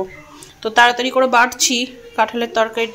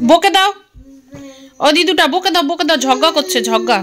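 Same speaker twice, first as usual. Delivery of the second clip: loud, higher call for help; 4 semitones higher, 5 dB louder than usual. Real, not acted. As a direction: shout across the room to grandma. Calling grandma to scold the girls.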